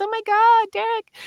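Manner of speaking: very high squeal of surprise; astonished recognition. Retold or imitated. Old friend recognizing Derek at a gig, high squealing surprise.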